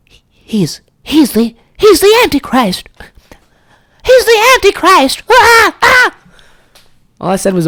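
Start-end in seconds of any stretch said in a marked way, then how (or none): 0.1-5.2 whispered then rising to a high, loud shriek of "Antichrist"; mock panicked little brothers, climbing to a shriek; scandalised kids. Little brothers at dinner, horrified, whisper building to a high shriek. 5.3-6.2 hammed-up shocked shriek, high and loud; high mock shriek. Brothers at dinner shrieking at the swear.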